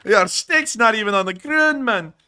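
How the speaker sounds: high loud meme imitation. Streamer repeats the meme to his co-host in a squeaky voice. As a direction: squeal the line like the meme guy. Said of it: "that meme".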